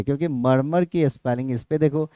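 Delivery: ordinary delivery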